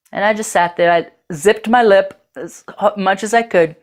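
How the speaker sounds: ordinary delivery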